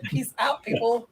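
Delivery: a hint of a child saying bye; imitating kid. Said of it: "Luke said".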